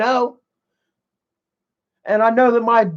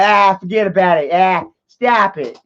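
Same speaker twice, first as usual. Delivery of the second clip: put-on Northern Yankee accent. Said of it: "this Northern accent".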